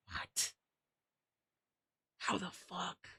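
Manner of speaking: hushed, breathy whisper of disbelief; stunned whisper. Real, not acted. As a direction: whisper it slowly, as if floored. Stunned by chatter's answer.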